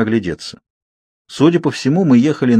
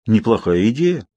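ordinary delivery

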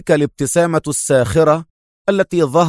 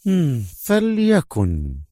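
higher, very slow resigned fliakun; calmed female voice; 3 semitones higher, much slower than usual. Acted. The major giving in to the inspector, slow and resigned. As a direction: stretch فليكن slowly, falling. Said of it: "تمالكت أعصابها".

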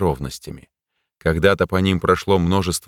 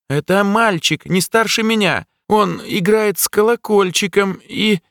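put-on high, eerie child voice; childlike voice; 12 semitones higher than usual. A narrator's reading. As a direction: speak like a possessed boy. Possessed jester, childlike.

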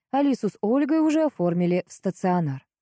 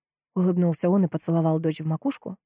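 plain reading voice

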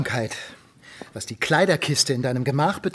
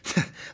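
short soft scoffing chuckle; disbelieving chuckle; scornful laugh; 5 dB quieter than usual. Acted. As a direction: huff a bitter laugh. Father scoffs at disguised daughter.

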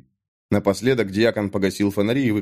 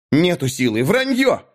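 much higher squeal on "Враньё!"; shrill denial; 6 semitones higher than usual. A narrator's reading. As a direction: panic rising into a squeal. Mik denies in panic. (Мик в панике визжит)

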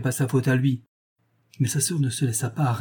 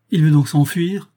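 louder and higher, jolted question; startled; 5 semitones higher, 6 dB louder than usual. A narrator's reading. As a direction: leap up and blurt it out. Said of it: "dit René en bondissant".

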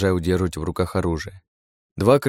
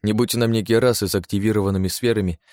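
ordinary delivery

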